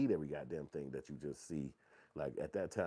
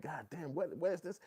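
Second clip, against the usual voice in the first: higher, wide-eyed surprise; amazed; 9 semitones higher than usual. Retold or imitated. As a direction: marvel at a new place. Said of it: "It's like".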